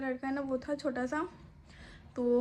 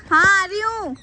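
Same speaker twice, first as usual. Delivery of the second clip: calling back; 8 semitones higher, 11 dB louder, slower than usual. Real, not acted. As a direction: shout back that you're coming. Answers son calling her. (ऊँची आवाज़ में जवाब देना)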